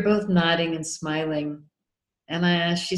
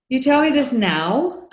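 stunned disbelief, slower and higher; higher, louder, drawn out in shock; incredulous; 7 semitones higher, 5 dB louder than usual. Retold or imitated. Retold shock at late news.

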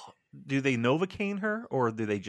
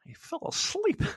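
sheepish squeak. Real, not acted. Sheepish confession of dozing off.

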